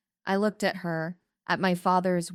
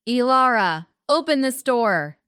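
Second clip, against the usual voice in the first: loud, high shouted demand; roaring; 7 semitones higher, 7 dB louder than usual. A narrator's reading. Father pounding on apartment door, roaring to be let in. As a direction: bellow it through the door. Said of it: "My father roared".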